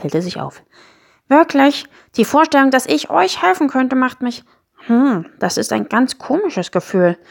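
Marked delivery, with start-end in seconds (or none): 1.3-7.2 higher voice, hesitant wonder; a small creature feeling its way into an odd feeling; little Miesmuffel, brightening up. Acted. Sad Miesmuffel discovers he could help, hesitant wonder.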